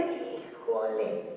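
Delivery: very low, very slow drawn-out híjole; an inward, disapproving "Híjole"; inner voice groan. Retold or imitated. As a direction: drag "Híjole" out deep and slow like a private groan. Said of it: "adentro usted está diciendo".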